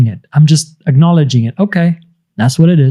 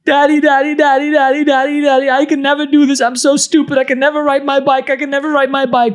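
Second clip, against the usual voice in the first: put-on high, whiny little-kid voice; whining child; 9 semitones higher than usual. Small child whining to daddy about the bike, high whiny voice. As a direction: whimper like a frustrated small child calling for dad. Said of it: "if that one child goes".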